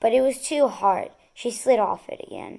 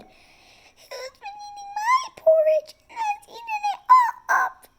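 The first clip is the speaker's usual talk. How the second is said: put-on tiny baby bear voice; 3 dB louder than usual. A narrator's reading. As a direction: squeak it out like a tiny weepy cub. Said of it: "In her tiny little voice".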